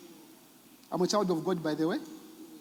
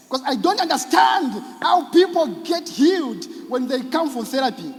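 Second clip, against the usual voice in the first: rising to a fervent shout; 7 semitones higher, 4 dB louder than usual. Real, not acted. Rising passion about healing.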